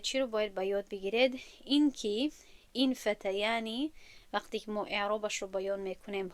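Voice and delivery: ordinary talk, nothing marked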